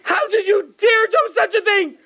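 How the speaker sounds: high-pitched indignant outburst; screaming. Acted. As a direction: keep up the furious mother's screeching. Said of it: "She didn't finish screaming yet".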